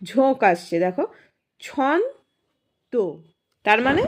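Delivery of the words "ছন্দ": "ছন্দ" stretched slowly into "ছন" ... "দ"; gap between syllables. Shown as a demonstration. Teacher showing where the gap falls, split in two. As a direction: raise the first beat of "ছন্দ", pause, then the second. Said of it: "একটা gap মতো আসছে".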